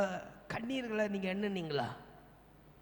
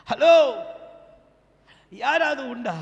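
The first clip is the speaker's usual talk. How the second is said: loud shout for a response; calling out; 4 semitones higher, 14 dB louder, slower than usual. Real, not acted. Preacher checking if anyone has counted, calling out. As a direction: holler to rouse a silent crowd. Calls out to a silent congregation.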